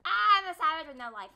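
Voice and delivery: high, loud declaration; hollered boast. Real, not acted. Gamer proclaiming himself a savage, loud holler. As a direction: belt the boast out.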